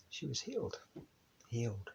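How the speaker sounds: hushed wonder. Acted. Climax of the story.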